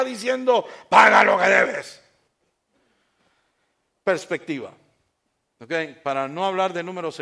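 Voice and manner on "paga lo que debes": loud, slow shout of a creditor; harsh demand. A narrator's reading. Servant choking fellow servant over debt, furious loud shout. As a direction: bark it at him as you choke him. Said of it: "echándole mano lo ahogaba".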